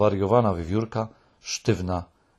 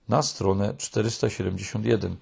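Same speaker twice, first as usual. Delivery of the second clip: ordinary delivery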